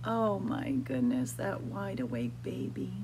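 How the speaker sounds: ordinary delivery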